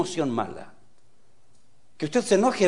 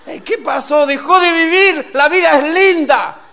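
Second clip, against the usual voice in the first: high, very loud rousing cry; exuberant shout; 12 semitones higher, 13 dB louder than usual. Acted. Preacher rousing joyless women to live, exuberant shouting. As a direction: shout it out to wake them up.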